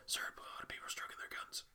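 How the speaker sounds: hushed, fast whispered aside; imitated confidential whisper; guard's whisper. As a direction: whisper a discreet warning to the boss. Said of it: "security guard going".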